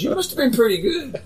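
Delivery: mock boss, pitched well up; boss's voice. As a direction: play the impressed boss, voice jumping high. Boss quoted in anecdote.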